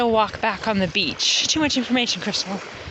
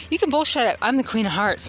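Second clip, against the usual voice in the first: ordinary delivery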